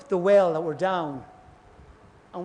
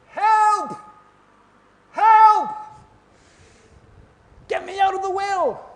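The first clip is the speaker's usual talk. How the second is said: high, loud cry for help from down a well; screaming for help; 14 semitones higher, 7 dB louder, slower than usual. Retold or imitated. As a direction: shout up from the bottom of the well. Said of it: "we are screaming".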